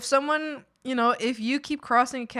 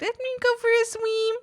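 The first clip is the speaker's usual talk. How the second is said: cat voice; 13 semitones higher than usual. Pretend. Cat voiced wanting to swim.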